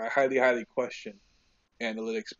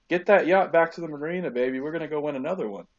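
louder and higher, pumped call to action; mock Tom Brady rallying; 6 semitones higher, 10 dB louder than usual. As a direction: bark it like a hyped leader, speeding up at the end.

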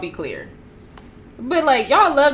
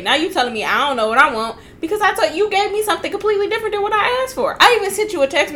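rising exasperated rant; 9 semitones higher, 4 dB louder, faster than usual. Real, not acted.

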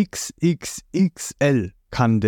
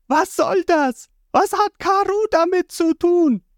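angry outburst. A narrator's reading. Vivi confronts the attackers over Karoo, high furious shout. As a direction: demand answers in outrage. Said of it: "brüllt Vivi".

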